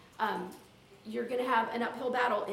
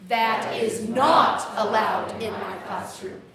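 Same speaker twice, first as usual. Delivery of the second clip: slow and loud, "not" punched hardest; stress on NOT; 2 semitones lower, 7 dB louder, slower than usual. Shown as a demonstration. Teacher drilling the next version, emphasis on NOT. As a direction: hit "not" hard and stretch it. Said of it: "Emphasis on not".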